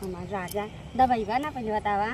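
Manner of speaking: low, soft wheedling; sweet coaxing. Acted. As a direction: coax the husband tenderly for a favour. Wife coaxes husband for leg massage. (धीरे से लाड़ में मनुहार)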